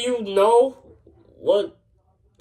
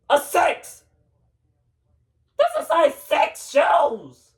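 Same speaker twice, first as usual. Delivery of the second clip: high, indignant shouting; raised, outraged complaint; 7 semitones higher, 3 dB louder, slower than usual. Acted. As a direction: shout it in high-pitched disbelief. Acted quarrel over shoe size.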